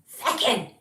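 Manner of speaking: loud mock yell across a field; yelling a dog's name. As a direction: call the dog from the far end of the field. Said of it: "just be yelling".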